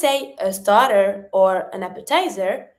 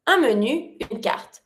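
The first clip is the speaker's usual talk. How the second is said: usual speaking voice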